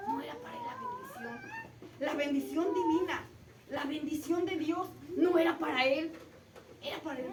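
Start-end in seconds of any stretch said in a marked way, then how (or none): 0.1-1.4 tearful. Real, not acted. Preacher feels for Isaac.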